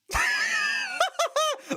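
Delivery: a burst of high, shrieking laughter; real helpless cackle. Real, not acted. Co-caster shrieking with laughter at his own bad accent.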